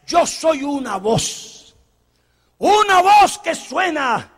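slow, swelling proclamation; solemn declaration. Acted. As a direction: announce yourself proudly. John declares himself.

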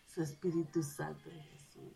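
whispered, the sentence dying away; reverent whisper. Real, not acted. Preacher naming God softly after her testimony, whispered. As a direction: breathe the holy names out softly.

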